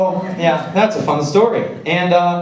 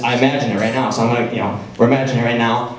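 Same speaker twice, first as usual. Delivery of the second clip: ordinary delivery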